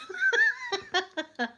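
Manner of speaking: high falling laugh trailing off; real laugh at her own punchline; long laugh. Real, not acted. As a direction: let a long laugh ring out and fade. Laughing at end of story.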